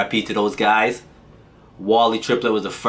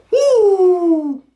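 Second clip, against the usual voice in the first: excited whoop; 7 dB louder than usual. Real, not acted. Hyped about waking up swole.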